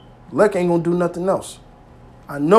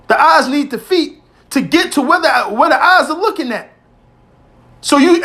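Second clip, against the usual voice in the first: raised, high-pitched preaching at full force; fired-up sermon voice lifting higher on each point; 7 semitones higher than usual. Acted. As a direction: preach it out to the congregation. Preaching to the brothers.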